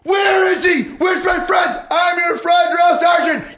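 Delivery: very high, loud shouted replies; high mock-frantic shouting; privates shouting back. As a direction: yell it like panicked privates scrambling to answer. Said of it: "And you're like".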